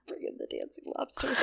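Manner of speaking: hushed, unvoiced, rising at the end; whispered flourish. Whispering a silly cue to her co-host. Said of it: "Bring in the lobsters".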